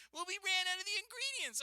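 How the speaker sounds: very high, put-on excuse; high mock cashier making excuses. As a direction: squeak it out like a lame excuse.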